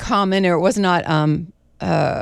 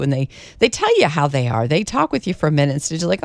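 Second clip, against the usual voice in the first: ordinary delivery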